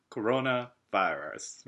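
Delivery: コロナバイラス in hammed-up English, split into two words; mock-suave English コロナバイラス; posed いい声 pronunciation. Shown as a demonstration. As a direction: pose on コロナバイラス with a pause before the end. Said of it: "ちょっと決めて書かなきゃいけないのかな".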